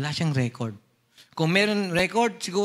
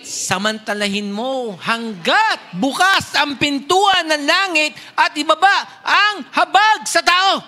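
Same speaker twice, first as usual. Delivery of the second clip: pleading exhortation; 8 semitones higher, 8 dB louder, slower than usual. Pretend. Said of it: "sabi mo sa kapatid mo".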